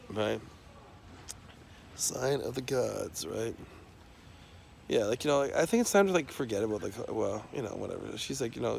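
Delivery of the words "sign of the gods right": slow, stretched-out SIGN OF THE GODS; drawn-out repeat. Shown as a demonstration. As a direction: draw out "Sign of the gods" at half speed. Repeats his phrase for effect.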